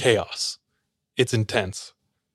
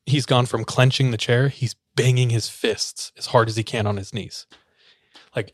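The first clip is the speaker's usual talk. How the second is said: normal talking voice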